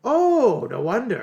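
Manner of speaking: high mock realization; exaggerated surprise. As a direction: play up the surprise.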